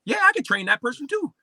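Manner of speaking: high-pitched, acted eagerness; eager yes. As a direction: jump at the offer with pitch way up. Replays eagerly accepting another job offer.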